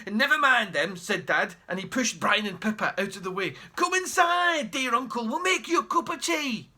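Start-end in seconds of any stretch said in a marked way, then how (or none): 0.1-1.0 a touch louder, eager dad. Acted. Dad hurrying the children out of the way, eager. 3.8-6.7 higher, sugary fawning host; butter up the rich uncle; fawning dad. Acted. Dad inviting the uncle in for tea, sweetly fawning.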